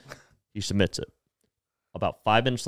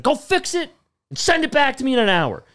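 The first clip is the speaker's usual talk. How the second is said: much higher, louder order; curt angry boss; 14 semitones higher, 5 dB louder than usual. Retold or imitated. Boss dismisses scolded worker.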